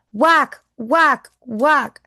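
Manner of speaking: storyteller acting out axe strikes; loud chopping sounds. A narrator's reading. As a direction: hit each whack like an axe blow. Reading chopping sound words.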